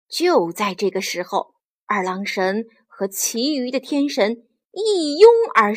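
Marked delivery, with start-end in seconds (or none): none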